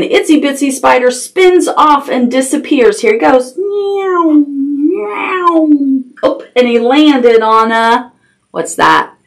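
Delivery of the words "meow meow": high "neeow" gliding downward; flying zoom noise. A narrator's reading. Spider spins off the cow and disappears, high zooming noise. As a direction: zoom it high like something spinning away.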